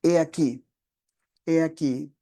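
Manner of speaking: preacher stressing He aquí; emphasis on He aquí. Shown as a demonstration. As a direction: lean on He aquí twice like a call to look. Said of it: "poner énfasis en esta expresión".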